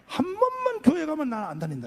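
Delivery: high, loud insistent bargaining; emphatic promise. Retold or imitated. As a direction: press the deal eagerly. Acted bargaining with sister.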